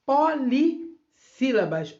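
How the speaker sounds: POLISSÍLABAS slowed, opening beats apart; split into syllables. Shown as a demonstration. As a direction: break off the start of polissílabas. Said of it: "elas são palavras".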